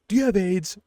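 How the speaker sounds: high, urgent imitated question; acted-out high-pitched demand; mock alarmed question. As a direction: blurt it high as the worried cop. Stabbed cop's sudden question, retold.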